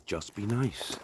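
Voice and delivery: soft, cool, unbothered bouncer line; Patrick Swayze quote. Retold or imitated. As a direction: say it quietly and calmly like a laid-back tough guy. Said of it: "what Patrick Swayze said in Roadhouse".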